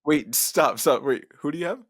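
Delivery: insistent interruption. Real, not acted. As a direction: stop him mid-word and demand it. Cutting in, demanding answer.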